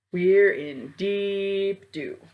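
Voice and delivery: slow, heavy dread; slow gloomy groan. Acted. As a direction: drag it out in mock despair. Lou's gloomy punchline.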